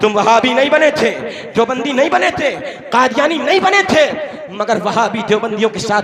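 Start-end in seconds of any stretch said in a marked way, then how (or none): none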